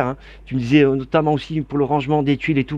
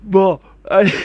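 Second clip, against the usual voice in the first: after laughing hard; 5 semitones higher, 5 dB louder than usual. Real, not acted.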